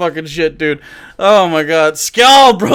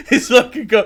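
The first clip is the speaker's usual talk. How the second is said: ordinary talk, nothing marked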